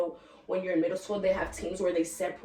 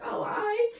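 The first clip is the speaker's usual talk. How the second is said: mock squeaky reply. Boy's surprised reply to her.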